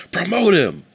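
high, loud hype shout; excited. Acted. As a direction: shout it like a promoter. Joking hype call.

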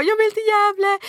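high, frantic plea near tears; desperate pleading. Retold or imitated. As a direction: beg the agent, on the verge of breaking down. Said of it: "Jag bara". (förtvivlad bön nära gråten)

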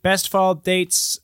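usual speaking voice

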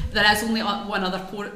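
normal talking voice

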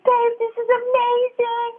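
lightly voiced husband, high and slow with delight; excited husband. Husband hearing the pregnancy news, high and delighted. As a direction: play the thrilled husband, drawn out and high. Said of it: "He's like".

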